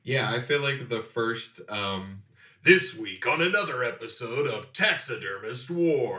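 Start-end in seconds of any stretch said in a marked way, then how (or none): 2.6-6.2 announcer voice, punchy and bigger; reality show announcer. Pretend. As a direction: introduce the show like a TV host. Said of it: "mock reality show intro".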